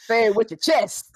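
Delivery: high, loud chesty cheer; rousing encouragement. Real, not acted. Sister urging sister to say the word boldly, loud rousing cheer. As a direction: belt it out to egg her on.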